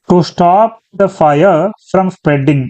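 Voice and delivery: very slow, word by word; slow reading. Shown as a demonstration. Teacher rereading the phrase for the class, very slow. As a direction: drag the phrase out so students can follow. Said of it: "ముందుగా ఇందులో subject".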